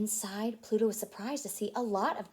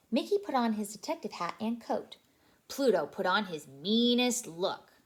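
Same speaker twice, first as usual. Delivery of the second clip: usual speaking voice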